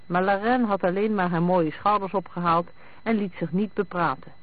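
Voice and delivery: usual speaking voice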